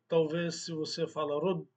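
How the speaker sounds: ordinary talk, nothing marked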